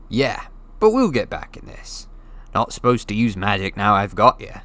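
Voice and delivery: put-on a giant speaking casually to a boy; Hagrid, big friendly giant. A narrator's reading. Hagrid explaining to Harry, casual giant voice.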